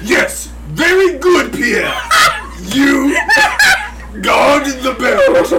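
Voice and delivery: slow, loud, high dumb orc; dopey cartoon bellow. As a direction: bellow it like a dim brute. Orc answers aloud in Orcish.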